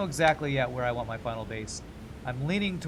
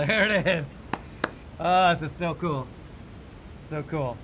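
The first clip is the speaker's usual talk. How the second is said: higher, louder burst of delight; excited; 4 semitones higher, 6 dB louder than usual. Real, not acted. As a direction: cheer as the awaited item appears. Item finally appears in storage.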